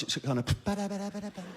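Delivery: normal talking voice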